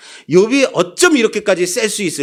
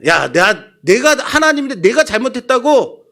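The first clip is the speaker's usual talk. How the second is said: angry God; 6 semitones higher than usual. Acted. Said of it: "하나님도 꽤나 화가 나신 것 같아요".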